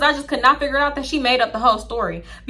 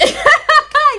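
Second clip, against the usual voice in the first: loud, high burst of laughter; 7 dB louder than usual. Real, not acted. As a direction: crack up at your own story. Laughs at her own re-enactment.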